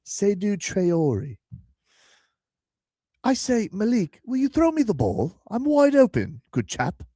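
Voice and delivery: high, very proper British impression; posh British accent. Host mimicking the British tight end, posh British accent. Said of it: "he sounds much more proper".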